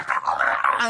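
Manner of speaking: low, strained comic yell; growled pretend scream; mock scream. Jerk attacked over the table, low mock scream. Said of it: "She's, like, screaming".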